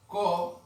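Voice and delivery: co called out loud; teacher's model word. Shown as a demonstration. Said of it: "si yo digo". (palabra modelo co, dicha fuerte)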